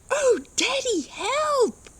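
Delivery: very high, loud little voices crying for help; tiny panicked kids calling their dad; frightened mole children. Acted. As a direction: squeal it like scared baby moles. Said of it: "Mole's children cried".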